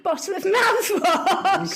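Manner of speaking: slow, cracking up on the punchline; laughing. Real, not acted. Host reading a listener's toothbrush mishap, laughing. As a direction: let laughter break into the words, drawn out.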